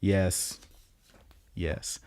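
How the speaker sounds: soft, halting yeses with pauses; mock gasping tears. Violet says it three times, understated tearful imitation. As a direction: lightly imitate saying yes through tears. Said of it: "through her gasping tears".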